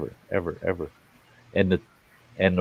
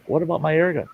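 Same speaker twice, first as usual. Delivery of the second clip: put-on whiny young man asking; 9 semitones higher, faster than usual. Nephew quoted asking for gun.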